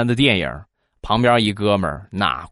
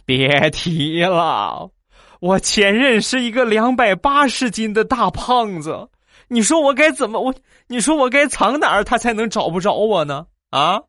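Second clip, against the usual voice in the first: much higher, mock sobbing complaint; a crying guy tells of his huge ex, higher and wailing; 10 semitones higher than usual. Hammed-up wail. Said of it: "这哥们儿哭得更惨了".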